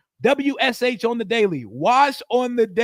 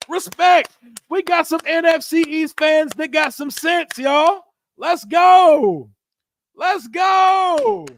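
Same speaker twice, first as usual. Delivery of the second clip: high, loud hyped shout; 7 semitones higher, 6 dB louder, slower than usual. Real, not acted. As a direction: shout it up with the fans. Hyped celebration.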